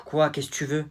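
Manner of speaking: ordinary talk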